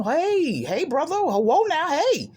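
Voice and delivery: high, bright mock of a pushy man; hammed-up "hey brother"; mocking his pushy greeting. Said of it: "trying to talk to me like I was nobody".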